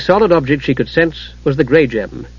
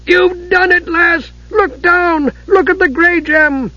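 dwarf's triumphant shout; slower than usual. A narrator's reading. Dugan shouting to Usha about the gem. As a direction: shout the news with joy. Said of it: "Dugan give a tremendous shout".

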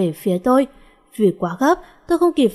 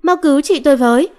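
higher, louder urgent cry; yelling for help; 5 semitones higher, 5 dB louder, faster than usual. A narrator's reading. Girl begs others to save her sister, urgent shout. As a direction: shout a plea for rescue. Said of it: "Thế là tôi hét".

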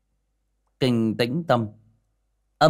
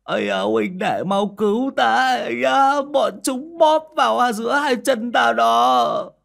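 very high, loud, drawn-out comic wail; whimpering wail; 13 semitones higher, 6 dB louder, slower than usual. A narrator's reading. Tiểu Minh begs Chí Dũng for rescue, whimpering high wail. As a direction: wail for help in hammed-up distress. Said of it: "nhìn Chí Dũng mếu máo".

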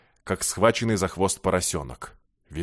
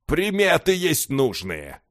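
slow, higher, knowing announcement; meaningful; 6 semitones higher, slower than usual. A narrator's reading. Beggar hints to the others about the omens, slowly and meaningfully. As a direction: draw it out significantly. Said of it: "многозначительно сообщил нищий".

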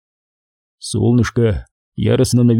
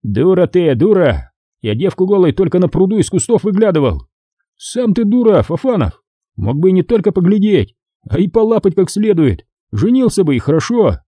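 raised, higher, jeering voices; bickering soldiers; 7 semitones higher than usual. Acted. Soldiers mocking each other about girls, heated and raised. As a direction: trade taunts like barracks mates.

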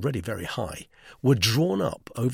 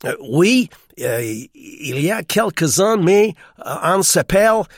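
put-on loud, high girl struggling in French; hesitant American girl's schoolroom French; girl's poor French; 6 semitones higher, 10 dB louder than usual. A narrator's reading. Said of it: "was little better than her brother's".

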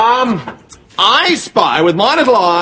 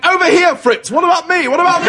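mild mock shout; 7 semitones higher than usual. Lieutenant imitates the captain calling to Fritz, mild mock shout. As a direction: call out over the trench, hammed-up. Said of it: "and shouted".

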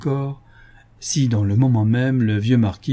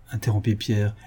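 ordinary delivery, nothing marked

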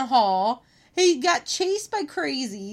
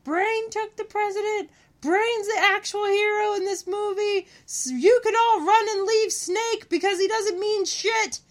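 mock yelling minion; 8 semitones higher than usual. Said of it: "he's yelling to all the crazy".

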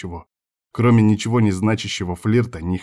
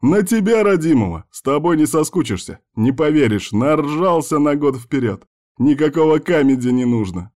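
higher, exuberant banter, easing slightly; gleeful; 6 semitones higher, 4 dB louder than usual. A narrator's reading. Friend declaring him better than comedy, gleeful. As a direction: gush with teasing delight.

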